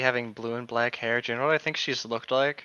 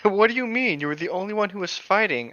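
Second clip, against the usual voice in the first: slightly raised, confused reading; mildly acted confusion; 6 semitones higher, 3 dB louder than usual. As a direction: sound puzzled by the claim. Reading a character's line aloud.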